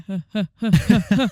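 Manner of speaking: breathy mock huh-huh laugh; imitated lazy laugh. Friend mocking his new laugh, lazy huh-huh laugh. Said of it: "this lazy laugh".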